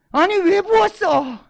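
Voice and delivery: high, loud, drawn-out outraged shout. Acted. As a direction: yell the complaint slowly and high. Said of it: "이렇게 난리가 난 거예요". (높고 길게 끌며 따지는 고함)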